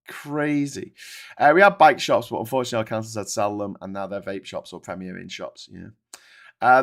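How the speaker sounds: ordinary talk, nothing marked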